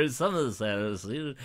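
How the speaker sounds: slow, drawn-out mock West Country Somerset; lightly mocking West Country Somerset. Shown as a demonstration. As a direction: stretch Somerset out lazily, a touch of mock yokel. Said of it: "um, Somerset".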